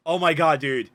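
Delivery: normal talking voice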